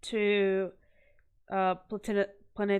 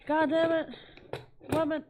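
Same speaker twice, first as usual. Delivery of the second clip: frustrated outburst; 7 semitones higher than usual. Real, not acted. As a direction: curse at yourself for losing it. Frustrated at losing the sticker.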